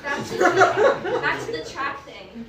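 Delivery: loud, high, slow laughing words; laughing while talking. Real, not acted. Getting back to the story, still cracking up loudly. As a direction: drag the words out through laughter.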